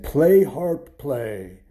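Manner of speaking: commanding giant. Acted. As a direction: order the harp like a booming giant. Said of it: "And he said".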